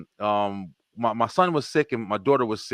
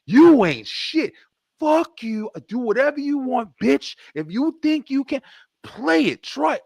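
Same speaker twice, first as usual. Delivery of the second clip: put-on old angry self; 10 semitones higher than usual. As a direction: act out the old hothead picking a fight. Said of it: "like I used to would have handled that situation".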